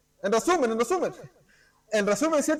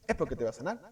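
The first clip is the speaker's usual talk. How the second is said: ordinary talk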